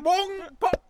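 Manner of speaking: high voiced bong and pop; cork-pop noises. Acting out the champagne opening, high cork-pop sounds. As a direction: imitate a champagne cork flying out.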